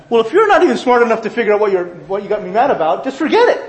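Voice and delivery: hammed-up huffy wife, fast and high; wife's exasperated retort. Wife snapping at her husband in a late-night argument, loud and high. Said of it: "she'd say".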